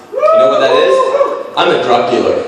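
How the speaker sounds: laughing. Real, not acted. Joke about pharmacist job.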